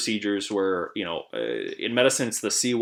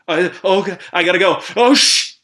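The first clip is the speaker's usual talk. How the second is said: high, loud panicked yelps; 9 semitones higher, 12 dB louder than usual. Retold or imitated. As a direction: hear him lose it on the call. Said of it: "I just hear, like".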